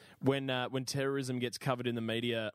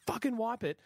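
high-pitched mock exasperation; strained high order, retold; exasperated, frustrated; 9 semitones higher than usual. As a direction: imitate a frustrated passenger's demand. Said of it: "exasperated, she's frustrated".

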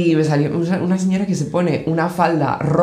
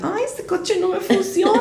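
much higher, slow, laughing mock whine; imitating TikTok lady; 4 dB quieter than usual. Retelling a TikTok video, high mock whine. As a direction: squeak it out like a helpless soap actress. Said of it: "lleva su coche y dice".

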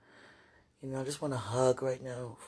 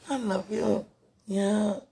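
loud; 6 semitones higher, 7 dB louder, slower than usual. Real, not acted. Loud declaration.

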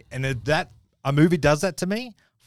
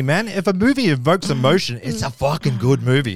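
usual speaking voice

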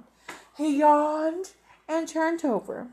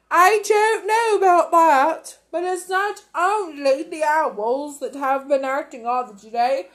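loud, chummy weather forecast; jovial weatherman; 4 semitones higher, 6 dB louder than usual. Acted. Weatherman jokes to viewers about shooting stars, loud and cheery.

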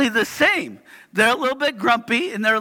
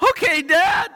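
put-on child's okay; 7 semitones higher than usual. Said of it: "Rama goes".

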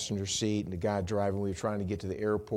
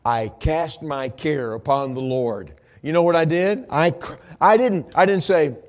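raised, loud pulpit delivery; preach; 6 semitones higher, 11 dB louder than usual. Real, not acted. Preacher telling congregation what he did in the wreck, preaching loudly. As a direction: drive it out to the back pews.

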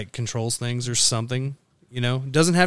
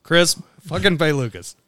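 louder, higher mock demand; loud call-out; 5 semitones higher, 6 dB louder than usual. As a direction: bark the order at Chris. Mock order to pay Lucas.

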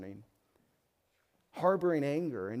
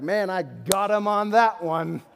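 louder, slow, gloating over a win; smug triumph; 5 semitones higher, 7 dB louder than usual. Pretend. An arguer gloating after proving his point, smug and loud. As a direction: savour winning the argument.

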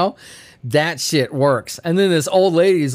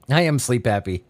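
ordinary delivery

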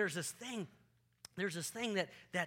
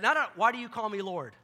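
loud challenge; 5 semitones higher, 9 dB louder than usual. Retold or imitated. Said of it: "And he said".

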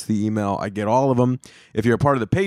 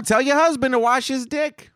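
much higher mock gossip voice; gossiping salon woman; 11 semitones higher than usual. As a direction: ham up a catty salon lady. Second salon woman answers.

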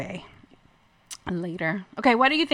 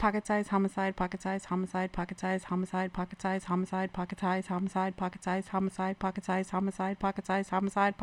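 rapid-fire repetition in a soft monotone; tongue twister, fast; 3 semitones lower, 10 dB quieter than usual. Shown as a demonstration. Racing through a tongue twister on a dare, fast. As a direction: rattle it off low and even. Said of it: "say that ten times fast".

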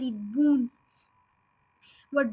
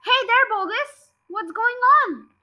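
calling out; 8 semitones higher, 5 dB louder than usual. Acted. Villagers calling down into crater.

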